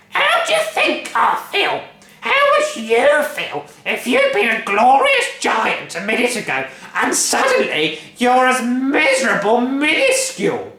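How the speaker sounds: high, loud ranting; furious rant. A narrator's reading. Grandma ranting at her daughter, loud and high.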